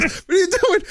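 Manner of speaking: high panicked dad cry; imitating father. Son mimicking dad's reaction to the prank, high cry. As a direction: squeal it like a startled father. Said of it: "he just goes".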